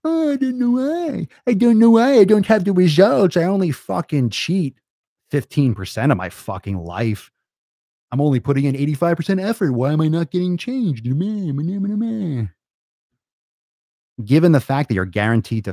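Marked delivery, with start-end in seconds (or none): 0.0-11.0 mock the lazy client in a high whine; hammed-up whiny voice, much higher; whiny mock complainer. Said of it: "and be like". 11.0-12.5 high bleating mock whine; sneering meh noises, higher. Mocking the complainer's whining.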